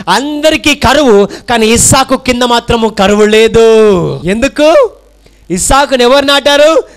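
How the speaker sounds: shouted, high-pitched sermon lines; full-force preaching. Acted. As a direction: preach it out with fire to the crowd. Preaching climax. (పూర్తి ఉత్సాహంతో బోధన)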